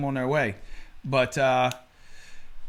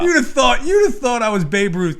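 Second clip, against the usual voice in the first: ordinary delivery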